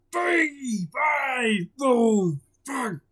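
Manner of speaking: loud, slow giant bellow; hammed-up giant shouting. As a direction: boom it out like a giant sniffing prey. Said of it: "shouted the giant".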